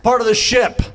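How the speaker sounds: high shouted rally call. Real, not acted. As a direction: bellow it to the whole room. Crowd call, louder try.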